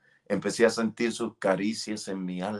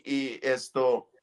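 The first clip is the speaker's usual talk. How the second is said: usual speaking voice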